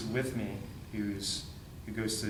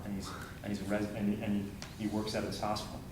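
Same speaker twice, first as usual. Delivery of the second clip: normal talking voice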